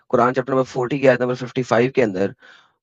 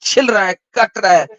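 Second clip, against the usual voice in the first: dramatic build; 4 semitones higher, 3 dB louder than usual. Retold or imitated. Dramatizing the prophet's dying pain.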